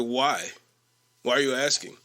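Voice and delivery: lightly imitated low, unbothered reply; dismissive coworker. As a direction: mimic a coworker brushing off the question.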